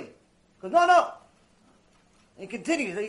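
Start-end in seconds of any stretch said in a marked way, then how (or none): 0.7-1.1 put-on high, loud little-boy protest; little boy's refusal. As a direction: refuse like a stubborn child, pitched up. Little boy refuses adult's order.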